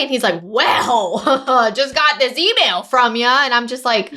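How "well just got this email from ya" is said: mock angry agent. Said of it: "he is just mad".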